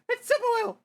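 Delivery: very high, loud fangirl voice; hammed-up, high-pitched shriek; squealing fangirl. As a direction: squeal at spotting an idol. Fangirls spot the hero.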